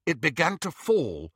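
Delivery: plain reading voice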